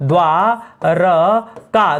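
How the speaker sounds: द्वारका drawn out very slowly, dwa-ra-ka with no i; correct द्वारका. Shown as a demonstration. Teacher giving the correct word dwarka to students. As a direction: stretch every sound of द्वारका. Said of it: "शब्द होता है द्वारका".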